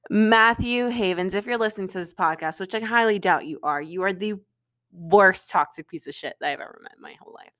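ordinary talk, nothing marked

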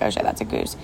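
low startled whisper; whispered alarm. Retold or imitated. Seeing a goose in the lane while driving, a low whisper. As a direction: breathe it out, caught off guard.

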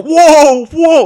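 high, loud straining whoa; straining shout. Acted. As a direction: yell while pulling hard on a rope. Gnome pulls lasso rope.

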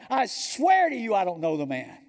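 loud, high swearing, falling at the end; emphatic oath. Acted. Peter swears to bystanders he's no follower, loudly emphatic. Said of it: "That's how emphatic he's being now".

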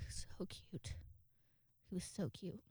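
very soft, hushed gushing; adoring whisper. Real, not acted. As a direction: whisper it, melting over the pet. Gushing over little cat's antics.

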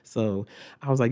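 normal talking voice

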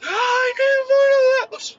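high, slow sob-shout on the in-breath; bawling while inhaling. Retold or imitated. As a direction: wail it through tears like a hurt child. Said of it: "breathing in and saying that at the same time".

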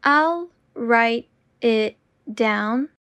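slow and deliberate. Shown as a demonstration. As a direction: say it slowly and clearly. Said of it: "repetition drill, slowed take".

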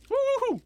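high pained hooting from spice burn; pained high woo-hoo. Real, not acted. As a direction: hoot it out through the burn. Burning from the hot chip.

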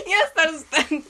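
high, laughing burst into words. Real, not acted. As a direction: crack up while answering. Proud of joke answer.

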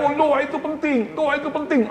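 mocking pastors. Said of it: "banyak hamba Tuhan percaya".

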